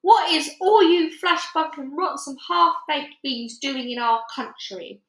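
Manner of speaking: a loud giant's rant, slowing at the end; angry giant. A narrator's reading. Giant berating the soldiers, loud and slow. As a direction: shout down at the tiny soldiers.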